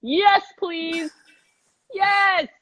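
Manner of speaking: high, delighted bursts; eager high-pitched yeses with short gaps; excited exclamations. Real, not acted. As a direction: cheer for an idea you love. Gushing about time-travel show premise.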